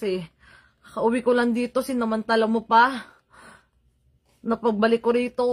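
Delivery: normal talking voice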